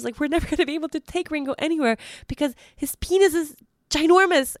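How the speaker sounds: high, frantic panicked pleading; panic attack. Retold or imitated. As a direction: rattle it off in a full-blown panic. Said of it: "a full panic attack".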